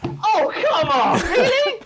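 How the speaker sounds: high, loud wail of disbelief; exasperated protest. Real, not acted. Contestant beaten by one extra letter, exasperated squeal.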